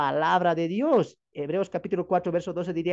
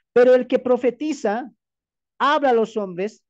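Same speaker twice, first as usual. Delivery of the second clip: scripture read aloud; 5 semitones higher, 6 dB louder than usual. Acted. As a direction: proclaim the verse to the congregation.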